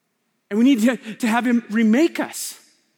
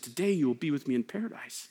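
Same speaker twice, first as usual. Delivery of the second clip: tender promise; 9 semitones lower, 10 dB quieter than usual. Acted. Jesus's gentle promise.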